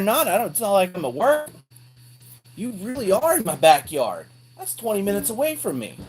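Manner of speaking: loud, high-pitched astonished exclaiming; excited disbelief. Real, not acted. Vlogger learns viewer is in his backyard, loud disbelief. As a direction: call out to a buddy in high, loud amazement.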